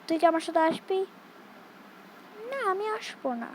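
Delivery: ordinary talk, nothing marked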